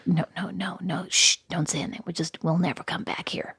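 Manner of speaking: soft, hushed, secretive voice; imitating a husband whispering at a restaurant table; fussy whispered husband. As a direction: hush her like a fussy granny. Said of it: "making him sound like a little California granny".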